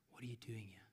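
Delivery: soft, unvoiced whisper; still small whisper. God to Elijah outside the cave, in a still whisper. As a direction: whisper it very quietly. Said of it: "still whisper".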